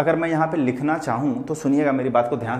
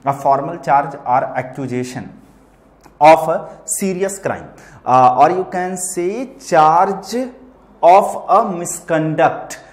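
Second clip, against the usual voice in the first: very slow, drawn-out reading with louder key words; slow dictation; 2 semitones higher, 6 dB louder, much slower than usual. Real, not acted. Teacher dictating a definition to students, slowly and emphatically. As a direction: spell the definition out word by word, with pauses.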